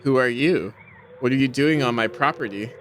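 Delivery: usual speaking voice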